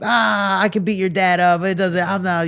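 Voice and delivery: high, loud cocky boasting; cocky teenager. Retold or imitated. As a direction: brag like a cocky kid. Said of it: "we're all cocky teenagers".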